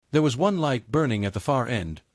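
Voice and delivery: ordinary delivery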